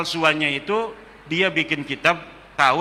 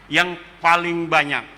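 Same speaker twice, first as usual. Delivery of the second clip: ordinary talk, nothing marked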